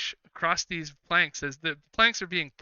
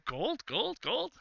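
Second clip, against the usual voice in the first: curious echo; 9 semitones higher, 5 dB quieter, much slower than usual. Acted. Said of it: "they're all like".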